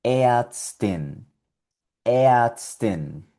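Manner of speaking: Ärztin as easten, R vocalized; German Ärztin. Shown as a demonstration. Teacher modeling German Ärztin. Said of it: "y suena".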